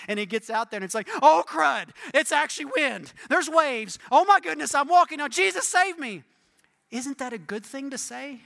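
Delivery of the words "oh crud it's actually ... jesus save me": loud, high mock panic; hammed-up terrified shouting; panicked cry. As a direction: yell in mounting alarm, pleading for rescue. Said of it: "cried out".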